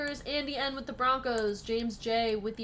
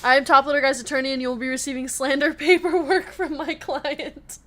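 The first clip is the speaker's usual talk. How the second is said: fast and louder, breaking into laughter; laughing while reading; 7 dB louder than usual. Real, not acted. Reading the attorney joke from chat, laughing and fast. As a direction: rush the line and crack up.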